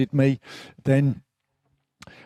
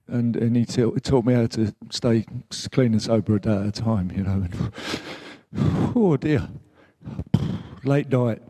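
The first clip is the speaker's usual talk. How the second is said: halting, with long heavy sighs between phrases; real teary; 3 semitones lower than usual. Real, not acted. Man honouring the late therapist who saved him, teary. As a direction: fight back tears, pausing to blow out breath. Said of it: "That's why I get a bit teary".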